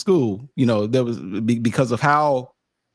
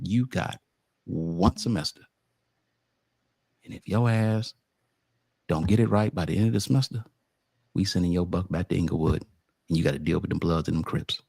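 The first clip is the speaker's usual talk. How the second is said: low, quiet mock ultimatum; stern warning; 9 semitones lower, 5 dB quieter than usual. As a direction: lay down the threat low and soft. Acts out school's stern ultimatum.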